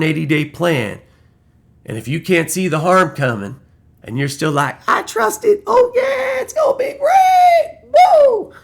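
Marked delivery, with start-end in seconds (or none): 4.9-8.5 squealing very high and loud, cheering; mock cheering believer. Vlogger mocks a blind Trump believer with a shrill, cheering squeal. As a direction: shriek it like a giddy fanboy.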